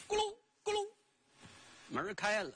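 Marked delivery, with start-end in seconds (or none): 0.1-0.9 high imitated rumble of a gate; door creak noise. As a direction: mimic the gate rattling open. (高声模仿开门声)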